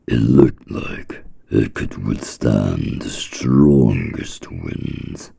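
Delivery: usual speaking voice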